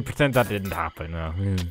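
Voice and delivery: ordinary talk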